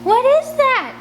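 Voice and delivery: a high, loud, startled cry of fear; frightened. Acted. Scarlet backing away from the fluttering in the cave, frightened. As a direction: she jolts at the sudden noise in the dark.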